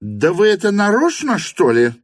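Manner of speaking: loud, high-pitched indignation; angry cry. A narrator's reading. Madam suspects they do it on purpose, angry cry. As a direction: cry out in anger at being thwarted. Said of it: "крикнула в гневе мадам".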